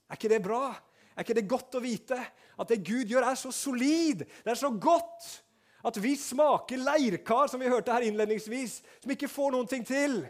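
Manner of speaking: loud, high, jubilant sermon call; preaching at full force. Acted. Pastor rejoicing to the congregation, loud and high. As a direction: lift the room, rejoice in it.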